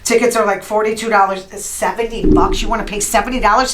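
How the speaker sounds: ordinary talk, nothing marked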